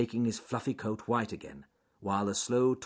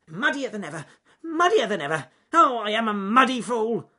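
high, cross squeaky outburst, growing louder; bad-tempered squirrel; 13 semitones higher, 9 dB louder than usual. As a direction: fume in a squirrel voice. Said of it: "he said as he dripped bad-temperedly".

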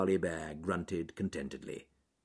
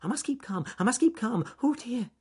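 high, panicky rabbit self-talk; frightened; 4 dB louder than usual. A narrator's reading. Rabbit running in circles, frightened, trying to stay calm. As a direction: repeat it higher as panic climbs. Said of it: "in frightened circles, thinking".

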